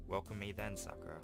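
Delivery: ordinary talk, nothing marked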